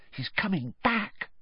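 high, soft, hushed; whispered. A narrator's reading. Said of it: "Hermione whispered".

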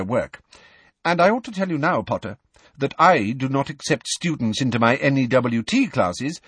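a hint of a brisk, stern professor. A narrator's reading. McGonagall voiced in story.

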